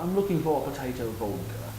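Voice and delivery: lightly attempted British accent; a rough, half-landed English accent impression; bad British accent impression; John Cleese. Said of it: "that's a bad John Cleese accent impression".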